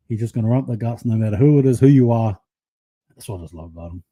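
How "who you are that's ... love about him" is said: normal talking voice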